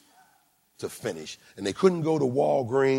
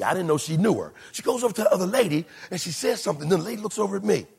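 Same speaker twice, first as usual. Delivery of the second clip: normal talking voice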